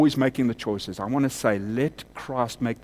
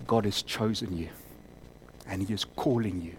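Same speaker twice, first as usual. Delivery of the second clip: quiet appeal; 5 semitones lower, 8 dB quieter than usual. Real, not acted. Quiet altar call to one man.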